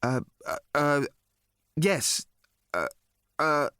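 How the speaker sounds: halting, bewildered uhs; flustered stammer. A narrator's reading. Arthur confirming his name to the alien, flustered. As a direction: stumble helplessly around a yes.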